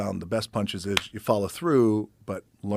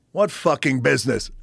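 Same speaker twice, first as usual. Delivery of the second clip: incredulous gruff bark; 6 semitones higher, 6 dB louder than usual. Retold or imitated. Pat scoffing at the young caller.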